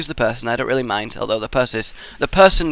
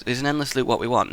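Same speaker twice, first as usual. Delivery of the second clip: ordinary talk, nothing marked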